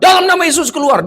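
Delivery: high-pitched, forceful command; retold exorcism command. Minister orders the spirit out in prayer, high commanding cry. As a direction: re-enact casting out a spirit, pitch right up. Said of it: "Berapa kali saya mendoakan".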